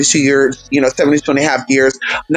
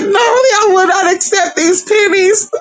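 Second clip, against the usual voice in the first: put-on old lady voice. Imitating the old lady cashier.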